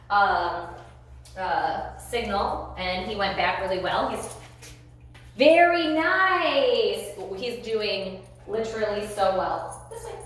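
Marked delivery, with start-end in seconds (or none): 5.4-7.1 very slow, loud, high praise; stretched praise. Real, not acted. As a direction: draw it out to reward the dog.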